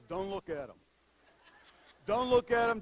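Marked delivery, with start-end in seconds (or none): 2.1-2.8 louder repeat. Retold or imitated. Pastor repeating his advice to Rod, louder. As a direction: say it again much louder, pressing "look". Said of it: "first advice I told Rod was".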